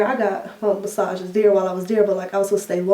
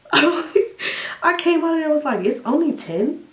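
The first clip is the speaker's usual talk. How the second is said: surprised; 8 semitones higher than usual. Retold or imitated. Shocked at short surgery time.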